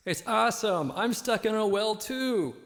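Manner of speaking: high, loud echoing shout; shouting from a well. Pretend. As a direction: holler up from the bottom of a well. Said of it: "shout at each other from far away".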